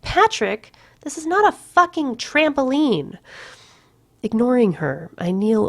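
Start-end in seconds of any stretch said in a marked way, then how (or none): 0.0-3.2 much higher irritated snap. A narrator's reading. Courtney snapping at Patrick for jumping on the futon. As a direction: bite it off, annoyed. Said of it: "causing her to snap".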